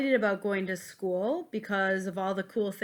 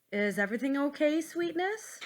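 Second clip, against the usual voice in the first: lightly voiced mother, pitched up; concerned mom; 7 semitones higher than usual. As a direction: ask higher, with a touch of motherly concern. Mother checking on her child.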